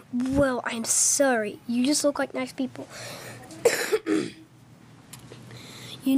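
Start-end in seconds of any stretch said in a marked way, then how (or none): none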